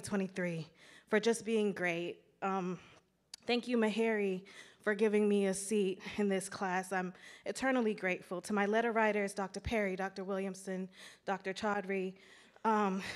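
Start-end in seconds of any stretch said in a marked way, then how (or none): none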